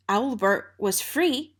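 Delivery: ordinary delivery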